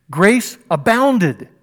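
ringing grace abounded; emphatic. Acted. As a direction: proclaim it slowly. Climax of the verse.

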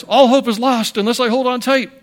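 hammed-up child clinging on for dear life; grandson's fearful cry. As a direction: grandpa playing his scared grandson. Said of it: "he looks at it and goes".

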